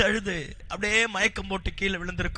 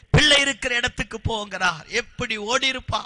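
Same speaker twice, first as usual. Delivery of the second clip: ordinary talk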